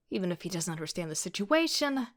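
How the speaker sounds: ordinary delivery, nothing marked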